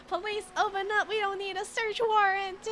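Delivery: hammed-up loud tough cop; mock tough cop. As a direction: imitate a brash cop kicking doors. Mocking aggressive American cops.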